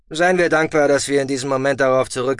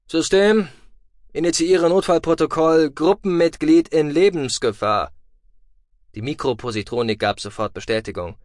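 ordinary delivery, nothing marked

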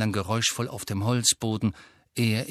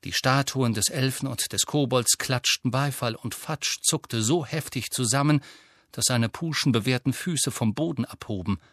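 usual speaking voice

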